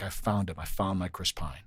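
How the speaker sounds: low, soft reenactment of a breakthrough; hushed retold discovery. Student tells his class of the breakthrough, low and hushed. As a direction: quote the student in a low, dropped voice.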